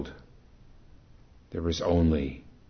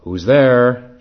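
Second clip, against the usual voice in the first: higher, louder call through the lock; calling through the door; 7 semitones higher, 13 dB louder than usual. Acted. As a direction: call out to whoever knocks. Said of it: "she asked through the lock".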